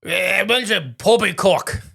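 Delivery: mock drunk British voice; drunk British accent. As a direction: ham up a tipsy British gentleman's sneer. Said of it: "reenact this guy".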